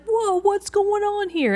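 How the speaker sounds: put-on high, slow, abrasive Brooklyn-accented voice for Trip. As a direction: play the newly smart bird with a pushy Brooklyn accent. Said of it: "he kinda sounds like he's from Brooklyn".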